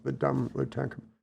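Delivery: quick, soft self-mocking aside; self-mocking mutter. Aide mocking himself in front of the general, soft and quick. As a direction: mutter it fast under your breath at yourself. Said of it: "I'm like".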